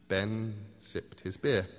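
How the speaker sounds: plain reading voice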